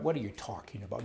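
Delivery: ordinary talk, nothing marked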